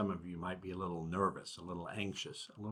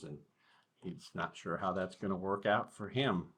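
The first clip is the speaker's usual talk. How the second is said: plain reading voice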